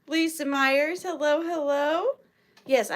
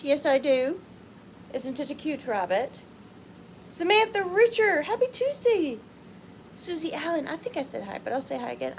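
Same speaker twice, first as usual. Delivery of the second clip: ordinary talk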